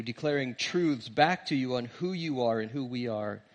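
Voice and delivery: hushed, reverent congregational prayer. Real, not acted. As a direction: pray quietly and unhurriedly to God.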